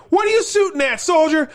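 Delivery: high barked officer's question; Martin Sheen. Retold or imitated. Officer demanding answers from a gunner, high shout. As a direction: call out sharply to the soldier. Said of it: "Martin Sheen's like".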